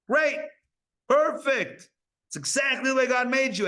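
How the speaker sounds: high bright-eyed mock approval; mock cheerful. Teacher cheerfully accepting students' temptations, mock upbeat. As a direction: cheer them on, half tongue in cheek.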